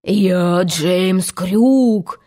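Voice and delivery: very slow, drawn-out captain's voice; Hook imitation. A narrator's reading. Peter poses as Hook, slow mock captain. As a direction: proclaim the name like a pirate captain. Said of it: "голосом самого Крюка".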